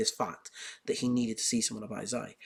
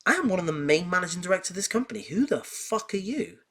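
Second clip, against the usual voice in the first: high, loud, indignant outburst; outraged manager; 6 semitones higher, 4 dB louder than usual. Retold or imitated. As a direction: bristle with outrage at the nobody. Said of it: "they're like".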